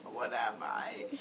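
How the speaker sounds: fake hurt pouting. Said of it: "totally a fake cry".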